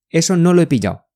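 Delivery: quick, run-together delivery; brisk native-speed model phrase; said quickly. Shown as a demonstration. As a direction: say it fast with "lo he" linked. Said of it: "你要说快一点啊".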